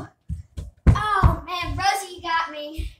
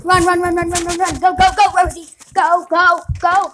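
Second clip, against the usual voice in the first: loud, frantic shouting; being chased; 3 semitones higher, 16 dB louder than usual. Acted. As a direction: yell to flee in a panic. Fleeing the spooky thing.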